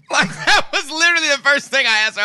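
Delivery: telling it through real laughter; laughing. Real, not acted. Telling a friend the story, laughing hard.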